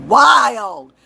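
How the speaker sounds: drawn-out, slow amazed exclamation; stunned. Real, not acted. Still shaken, telling viewers about her dream. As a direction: stretch out how shocking the dream was. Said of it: "I still haven't calmed down".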